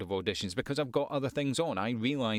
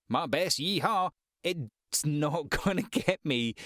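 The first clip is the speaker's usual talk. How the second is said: mock Texan accent; 4 semitones higher, slower than usual. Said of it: "a Texan accent".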